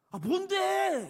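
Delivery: irritated snap. Retold or imitated. A just-woken son snaps at his father, irritated. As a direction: bark it like a grumpy kid just woken.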